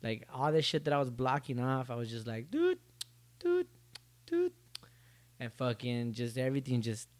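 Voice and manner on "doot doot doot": scanner beeps. Retold or imitated. Mimicking a scanner beep.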